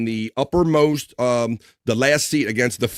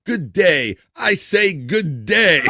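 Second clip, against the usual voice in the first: hammed-up gentleman, slow and higher; mock posh greeting; 8 semitones higher, 4 dB louder, much slower than usual. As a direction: drag out a mock-proper good day with a laugh. Reading a chat greeting aloud.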